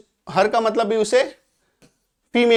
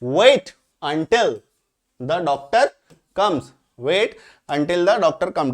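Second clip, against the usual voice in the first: the English sentence spelled out very slowly; slow teacher dictation; much slower than usual. Shown as a demonstration. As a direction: drag out each word for the class to copy. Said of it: "मतलब जब तक कि doctor आ ना जाए".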